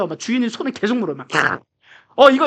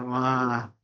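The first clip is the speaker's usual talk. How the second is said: usual speaking voice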